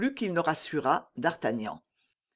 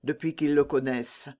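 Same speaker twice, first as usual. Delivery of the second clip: plain reading voice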